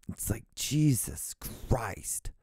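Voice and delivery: slow breathy whisper of relief; soft slow whispered exhale. Real, not acted. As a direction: whisper it, still shaken by the scare. Shaken after loud phone noise.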